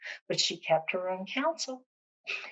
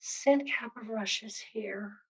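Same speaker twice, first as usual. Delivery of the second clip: weak, slow and softer, near death; tiny little voice; 5 dB quieter, slower than usual. Acted. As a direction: barely get it out, too weak to speak up. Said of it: "he said in a tiny little voice".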